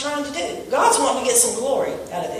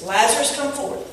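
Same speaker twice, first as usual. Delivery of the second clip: usual speaking voice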